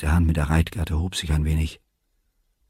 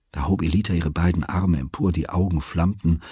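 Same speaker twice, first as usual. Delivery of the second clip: usual speaking voice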